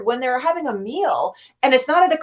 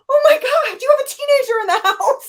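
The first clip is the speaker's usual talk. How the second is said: excited disbelief; 8 semitones higher than usual. Retold or imitated. Scolding friend in disbelief.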